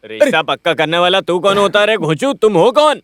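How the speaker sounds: high-pitched indignant outburst; irritated, voice jumping up; angry. Acted. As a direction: tell him off in a temper. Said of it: "अरे गुस्सा मत करिए".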